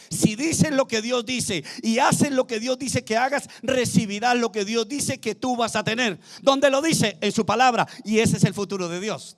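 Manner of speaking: forceful, emphatic sermon cadence; fervent preaching. Real, not acted. Preacher promising the church what God says, forceful. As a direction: drive each clause home to the congregation.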